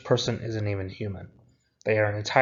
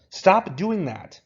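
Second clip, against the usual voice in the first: loud, high, drawn-out reprimand; slow, exasperated yell; exasperated scolding; 9 semitones higher, 8 dB louder, much slower than usual. Acted. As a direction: tell them off, stretching the words. David stops a friend shooting.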